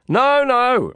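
high, loud refusal; shouted protest. A narrator's reading. Reluctant rider refusing to ride along, shouting high. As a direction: shout it to stop him. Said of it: "I shouted".

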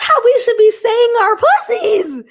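thrilled, loud and high; bursting with joy after the song; excited. Real, not acted. Reacting to the [name] track with high excited joy.

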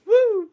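loud, high-pitched woo; excited whoop. Real, not acted. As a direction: let out a big high whoop. Whooping after laughing.

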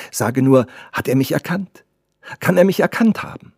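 high, urgent questions; anxious questioning girl. Acted. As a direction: press the servant for an answer.

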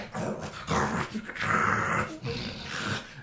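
rasping, snarling beast noises; creature growls. Acted. Game master voices the covered cages on the carts, rasping growls. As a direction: imitate caged beasts growling and hissing. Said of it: "you just hear sort of like".

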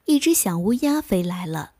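ordinary delivery

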